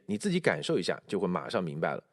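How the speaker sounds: usual speaking voice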